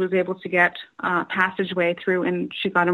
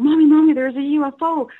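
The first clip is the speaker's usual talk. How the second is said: put-on higher, louder child's call for mom; excited kids calling mommy; 7 semitones higher, 5 dB louder than usual. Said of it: "my brother and I were both saying".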